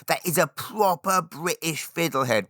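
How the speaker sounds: hammed-up British catchphrase; mock British expat. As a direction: tease the kids in a silly British voice. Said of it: "just do it to annoy them".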